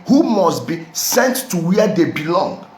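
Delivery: normal talking voice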